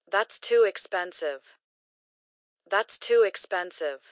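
ordinary talk

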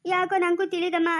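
usual speaking voice

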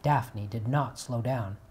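plain reading voice

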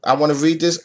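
usual speaking voice

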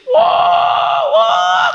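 mock wail, pitched very high; high drawn-out howl imitating a devil; imitated wailing shriek. Preacher imitating Iblis wailing as Fatihah descends, mock shriek. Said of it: "nangis-nangis, ngejerit".

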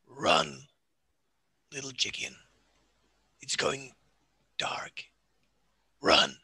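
menacing orc whisper. Acted. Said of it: "I lean to them".

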